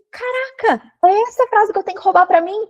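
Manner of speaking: high-pitched excited astonishment; amazed reaction. Retold or imitated. Acting out her amazed reaction.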